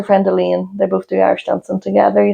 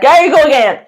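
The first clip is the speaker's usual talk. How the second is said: higher, louder mock exclamation; retold teasing outcry; 7 semitones higher, 5 dB louder than usual. Co-host quoting friends who heard her laugh, retold teasing outcry. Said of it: "They're like".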